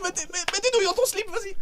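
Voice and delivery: very high, laughing TV host voice; Cyril Hanouna impression. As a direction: shout it like a rowdy TV host. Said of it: "cinq secondes de Cyril Hanouna".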